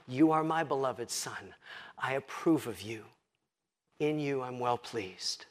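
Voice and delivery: tender assurance. Acted. Preacher voices God gently assuring his beloved Son. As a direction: speak it softly like a father blessing his son.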